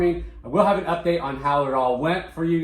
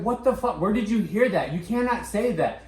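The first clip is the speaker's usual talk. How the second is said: shocked scolding; 7 semitones higher than usual. Retold or imitated. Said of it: "And I was like".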